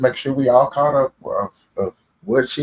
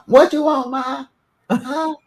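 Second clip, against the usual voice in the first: high-pitched mock kid answering mom; startled boy; 13 semitones higher, slower than usual. Boy answering his ma while on the phone, high startled voice. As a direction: jump way up high, playing a caught teenager.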